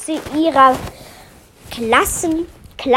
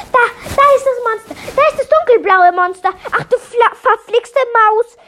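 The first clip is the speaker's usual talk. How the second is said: high, fast, loud panicked shout; screaming alarm; 9 semitones higher, 4 dB louder than usual. A narrator's reading. Townsperson spots the monster and shouts in alarm. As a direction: yell out that the monster is here. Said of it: "schrie sie".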